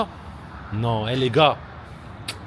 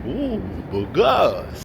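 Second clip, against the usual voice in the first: slow, teasing ouh to the camera; slow playful self-admiration; flirty self-compliment; 3 semitones lower, 3 dB quieter, much slower than usual. Real, not acted. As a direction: admire yourself slowly. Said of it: "je suis beau gosse sur la caméra".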